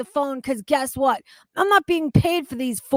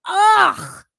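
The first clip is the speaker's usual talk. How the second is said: loud, high frustrated ugh; loud high exasperated ugh; disgusted groan; 8 semitones higher, 7 dB louder than usual. Real, not acted. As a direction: vent the disgust in one groan. Disgusted groan after rant.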